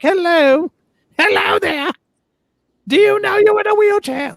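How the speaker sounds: very high, loud mock yelling; shrieked impersonation, bellowing each word at someone; Charles screaming. Co-host imitating the prince yelling at a woman in a wheelchair, mock screaming. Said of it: "looks like he's screaming at her".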